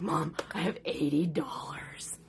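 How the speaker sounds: slow hushed whisper; slow secretive whisper. Retold or imitated. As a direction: breathe it like a secret. Son reporting his savings.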